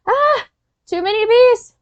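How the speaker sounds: high, alarmed cry at a swarm; panicked yelp. Acted. Knight character spots too many bees, alarmed high yelp. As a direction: yelp like bees are all over you.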